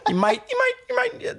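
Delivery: real giggles pushing the voice high; laughing. Real, not acted. Joking to friends about being forgotten, helpless laughter. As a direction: barely get the words out for laughing. Laughing at his own joke.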